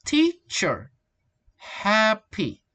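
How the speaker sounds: "teacher", "Happy" with the first syllable high and drawn out; strong first syllable. Shown as a demonstration. Teacher giving practice examples, first syllables of "teacher" and "Happy" stressed. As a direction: split the words, leaning on TEA and HAP. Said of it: "Strong syllable".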